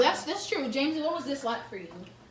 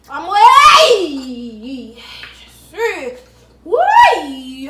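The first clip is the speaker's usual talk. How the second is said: shouting; 3 semitones higher, 16 dB louder, much slower than usual. Real, not acted. Spicy challenge, shouting in pain.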